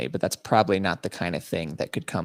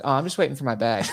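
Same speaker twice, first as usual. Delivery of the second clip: feigned innocence; 6 semitones higher, faster than usual. Retold or imitated. Playing oblivious to TSA lady.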